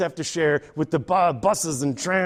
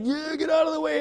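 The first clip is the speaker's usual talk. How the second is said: put-on irritated old cyclist; 9 semitones higher, faster than usual. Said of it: "and he's like".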